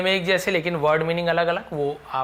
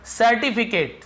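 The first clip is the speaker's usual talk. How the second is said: headword called out; 6 semitones higher, slower than usual. Shown as a demonstration. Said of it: "certificate यानी प्रमाण पत्र".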